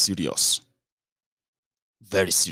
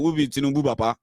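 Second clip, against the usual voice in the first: ordinary talk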